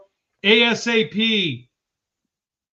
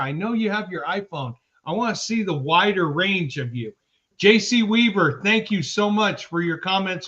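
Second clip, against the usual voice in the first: normal talking voice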